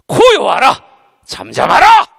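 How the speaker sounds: loud, very slow command; commanding rebuke. Shown as a demonstration. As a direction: command the storm in Jesus' name. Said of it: "딱 이렇게 말하면 되는 거 아니요". (예수 이름으로 명령하는 외침)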